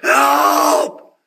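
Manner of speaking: high, drawn-out mock scream for help; retold scream. Patient on the corridor floor calling for a nurse, retold scream. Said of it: "I just scream".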